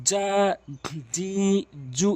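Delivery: "জা, জি, জু" with mid-tongue pressed to the palate; separate, held "জা, জি, জু"; firm palatal j on each of "জা, জি, জু"; jim articulation. Shown as a demonstration. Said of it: "জিমটি জিহ্বার মাঝখান উপরে তালুর সঙ্গে".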